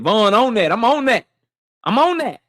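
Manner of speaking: imitating a young guy's hype; young cat voice. Shown as a demonstration. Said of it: "say it like the young, young cat said".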